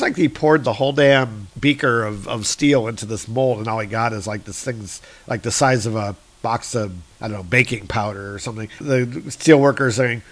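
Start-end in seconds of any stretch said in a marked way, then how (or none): none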